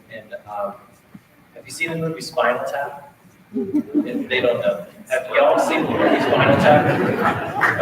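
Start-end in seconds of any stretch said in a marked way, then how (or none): 5.1-7.8 louder again, with a laugh in it; louder, laughing repeat. Real, not acted. Band director re-asks the audience louder, laughing. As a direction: call it out over the room, chuckling.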